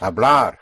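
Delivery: "Hablar" with one loud push on the final syllable -blar; single push on ending. Shown as a demonstration. Teacher models Spanish "to speak", loud final stress. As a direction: hit the end of "Hablar" hard. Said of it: "There's only one push".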